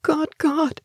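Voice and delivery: high-pitched, horrified exclamation. A narrator's reading. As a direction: cry to God in shock, without shouting. Horrified, shuddering in a corner.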